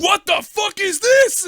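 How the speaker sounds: high, slow furious yell at the bartender; furious shout. Pretend. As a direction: scream it at the barman in mock rage. Acted angry bar patron.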